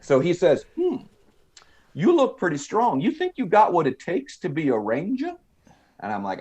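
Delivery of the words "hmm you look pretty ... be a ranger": high, swooping mock Southern drawl. Clerk sizing up a recruit's strength, grating Southern drawl. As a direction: appraise him in a put-on, grating Southern drawl. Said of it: "that Southern drawl accent".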